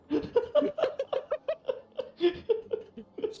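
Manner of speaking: high, long giggling laugh; real laughter. Real, not acted. Game master amused by the crystal answer, high laughter.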